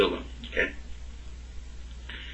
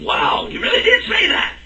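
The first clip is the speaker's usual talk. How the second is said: amazed. Retold or imitated. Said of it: "the brother with me was going".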